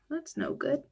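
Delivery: usual speaking voice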